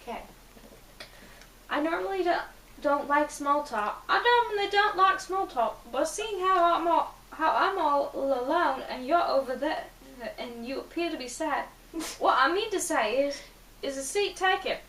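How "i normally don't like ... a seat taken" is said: put-on higher-pitched British young man; smooth British accent. Boy approaches a lone, sad stranger, in a British accent. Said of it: "said in a smooth British accent".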